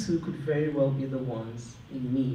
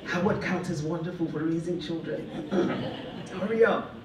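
laughing through a mock pitch, higher on hurry up; playful urging, giggling as she says it; laughing quote; 3 semitones higher than usual. Telling every newlywed she meets to hurry, laughing. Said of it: "I'll just probably tell every single man and woman I meet".

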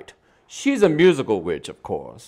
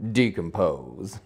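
dark lord; 5 semitones lower, 6 dB quieter, slower than usual. Acted. Punchline dropped low and slow.

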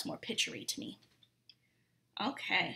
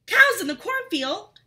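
high, loud alarmed question; startled cry; 9 semitones higher, 9 dB louder than usual. Acted. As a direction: blurt it out in sudden alarm. Tom startled by cows.

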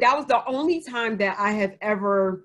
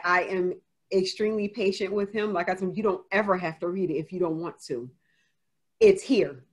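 usual speaking voice

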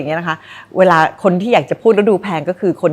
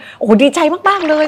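much higher, gushing overjoyed exclamation; overly dramatic joy; 10 semitones higher than usual. Shown as a demonstration. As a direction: ham up the delight as a bad example. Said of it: "อย่าแสดงออกถึงความรู้สึกหรือ feeling อะไรที่มัน dramatic มาก".

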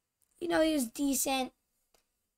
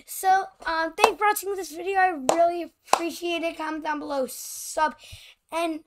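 higher, louder, fast cheery sign-off; excited outro; 6 semitones higher, 6 dB louder than usual. Real, not acted. Kid thanking viewers at the end of the video, excited and loud. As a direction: pump up the thank-you to viewers.

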